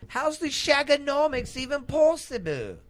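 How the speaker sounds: put-on very high, loud English accent; squealed, hammed-up English swinger; Austin Powers. Quoting the movie, high loud swinger impression. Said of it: "It's like Austin Powers said".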